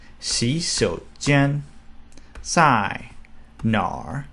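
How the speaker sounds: "洗手间在哪儿" slow, tone by tone; Mandarin tones. Shown as a demonstration. Teacher demos "洗手间在哪儿" for learners, slowly.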